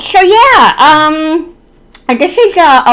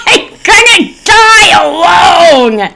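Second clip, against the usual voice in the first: laughing despair; 10 semitones higher, 4 dB louder, slower than usual. Real, not acted. Laughing self-pity outburst.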